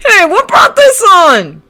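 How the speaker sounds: high, loud and slow call. Acted. Kirishima calling out to the two of them, high and loud. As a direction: shout over, worried. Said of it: "called out Kirishima".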